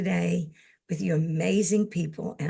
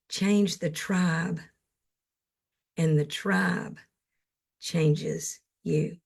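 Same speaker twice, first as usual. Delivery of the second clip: slow, measured quote reading with long pauses; slower than usual. Acted. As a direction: savour each phrase like a favourite quote. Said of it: "I'm gonna read something to you".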